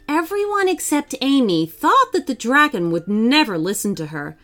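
ordinary delivery, nothing marked

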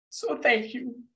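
high, choked-up, real gratitude; emotional thanks. Real, not acted. Closing a thank-you to teachers, genuinely choked up. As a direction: thank them while holding back tears. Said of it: "I get emotional about this".

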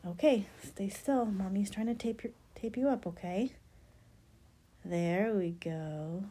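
ordinary talk, nothing marked

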